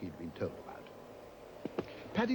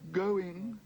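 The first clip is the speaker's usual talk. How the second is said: higher, pleading call; desperate auctioneer; 8 semitones higher, 3 dB louder than usual. A narrator's reading. Auctioneer desperately calling going to the room. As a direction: try to squeeze out a bid. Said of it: "said the auctioneer desperately".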